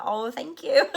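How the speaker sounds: high, giggling thank you; bashful thanks melting into a laugh; flustered thanks. Retold or imitated. Thanking the client for calling her beautiful, giggling.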